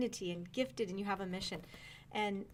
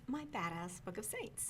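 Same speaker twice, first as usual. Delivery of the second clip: sheepishly quieter; 5 semitones lower, 11 dB quieter than usual. Real, not acted. A host shy about saying the book title on air, voice dropping softer. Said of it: "your voice got quieter when you said that".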